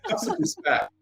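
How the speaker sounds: high, laughing through the reproach; laughing scold. Retold or imitated. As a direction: scold the friend while cracking up. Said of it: "And I'm like".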